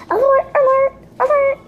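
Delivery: high, loud siren-like repeats; warning alarm. Acted. Acting an alarm against her cousin.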